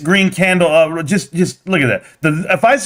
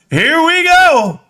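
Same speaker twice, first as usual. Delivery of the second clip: drawn-out hype; 7 semitones higher, much slower than usual. Real, not acted.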